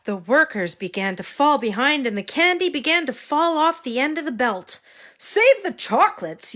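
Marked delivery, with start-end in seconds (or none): 5.2-6.6 high frantic shout; yelling. Acted. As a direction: yell it in a panic. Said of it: "yelled the man".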